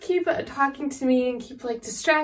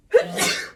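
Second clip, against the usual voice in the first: high rising sneeze; big acted sneeze; 4 dB louder than usual. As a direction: sneeze with a high gasping lead-in. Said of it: "a little bit of a sneezing fit".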